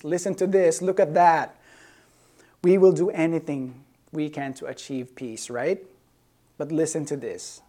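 normal talking voice

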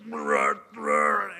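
deep comic horse saying bruh. Retold or imitated. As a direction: grunt it like an unbothered horse. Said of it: "And the horse is like".

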